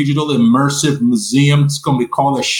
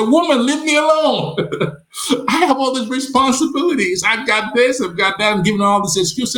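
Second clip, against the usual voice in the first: higher, half-laughing brush-off; mock exasperated protest; 10 semitones higher than usual. Husband brushing off his wife with excuses, mock exasperated and higher. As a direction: playfully wave her off with a pile of excuses.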